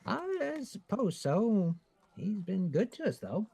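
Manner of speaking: NPC voice. Acted. GM voices mayor's assistant NPC.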